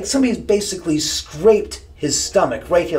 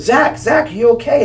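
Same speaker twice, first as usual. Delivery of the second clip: high, loud panicked calling; alarmed shout; 8 semitones higher, 4 dB louder than usual. Retold or imitated. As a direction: call out his name in alarm. Producer runs up calling out.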